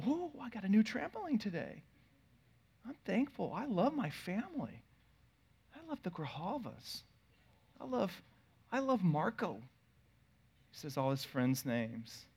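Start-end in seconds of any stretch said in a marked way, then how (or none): 0.1-9.6 lightly voiced little boy, soft and higher; child praying. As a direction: innocent small boy's prayer, softly. Four-year-old's prayer.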